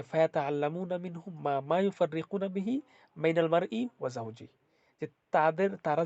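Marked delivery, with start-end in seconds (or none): 0.0-4.5 Quran recitation. Acted.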